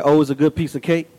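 low, quick, understated praise; unenthused. Shown as a demonstration. Preacher repeating his weak praise of caramel cake, unenthused. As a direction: toss it off without emphasis. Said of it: "Because I said".

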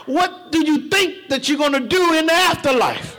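ordinary delivery

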